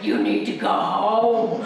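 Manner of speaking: slow, loud, deliberate order; firm send-off. Retold or imitated. Wife at deadline ordering her husband home, slow and firm. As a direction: lay down the law, slowly. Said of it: "I was like".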